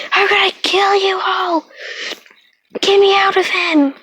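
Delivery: a trapped beast roaring to get out; monster yelling. Acted. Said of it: "you would hear a voice yell".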